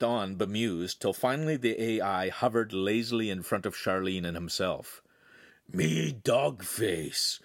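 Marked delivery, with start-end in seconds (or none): 5.7-7.4 slow, lazy dog growl; a bored robot dog rolling the words out slowly. Acted. Dog AI introduces himself, slow lazy growl. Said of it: "in a rolling, lazy growl".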